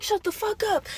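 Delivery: very high-pitched imitation; mock angry girl. Girl coming to fight, mocked in a high voice.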